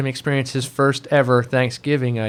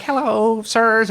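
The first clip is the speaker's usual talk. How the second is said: put-on higher, polite boyish greeting; lightly voiced earnest teen; young Butchie; 8 semitones higher, much slower than usual. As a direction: greet the bosses like a shy kid. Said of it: "And so he's like".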